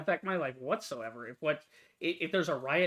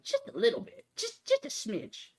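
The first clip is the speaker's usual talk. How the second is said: sarcastic understatement. Real, not acted. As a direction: squeeze out the words like a tiny pinch. Sarcastic understatement of a tiny change.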